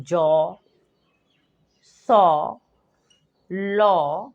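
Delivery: "जॉ", "सॉ", "लॉ" each with a clear open aw; teacher's slow aw demo; aw sound demo. Shown as a demonstration. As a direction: model "जॉ, सॉ, लॉ" slowly for a child. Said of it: "जो कि है ओ".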